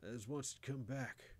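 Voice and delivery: halting, urgent deathbed warning in country dialect; dying old grandfather. A narrator's reading. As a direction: stumble through last orders to a grandson. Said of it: "he had another sentence or two".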